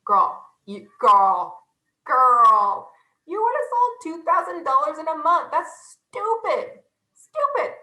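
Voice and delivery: put-on high, loud drawn-out girl, stretching stupid slowly; mock exasperated hype. Telling the hostess she's leaving money behind, hammed-up loud disbelief. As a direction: ham up the disbelief like a bestie. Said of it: "I'm like".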